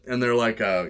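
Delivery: ordinary talk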